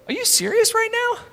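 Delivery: squeaky high disbelieving cry; incredulous. Retold or imitated. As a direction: act out shocked disbelief. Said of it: "we all go".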